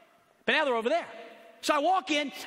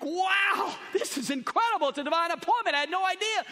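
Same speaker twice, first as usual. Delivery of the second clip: amazed; 9 semitones higher than usual. Retold or imitated. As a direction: burst out in astonishment. Amazed at divine appointment.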